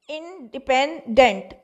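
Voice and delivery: Independent slowly, in three separate parts; a slow, divided independent; syllable by syllable. Shown as a demonstration. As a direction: part the syllables of independent for a class. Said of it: "ये तीन syllable हैं".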